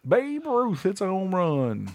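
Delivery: high, slow, stretched call; hammed-up vintage home run call; old baseball announcer. Calling Babe Ruth's homer, mock old baseball announcer. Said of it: "that baseball announcer".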